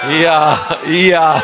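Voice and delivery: loud high braying I ja; donkey bray. Retold or imitated. Preacher brays like the donkey for the church.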